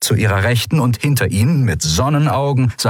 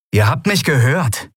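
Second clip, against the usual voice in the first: higher, insistent reply; firm insistence; 6 semitones higher than usual. A narrator's reading. Leader to his protesting crew, firm insistence. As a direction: brook no argument.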